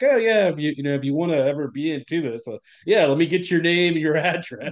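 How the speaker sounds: high, eager mock reply; a lightly voiced, too-eager agent taking his details. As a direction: play the Secret Service guy as overly friendly. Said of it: "the guy's like".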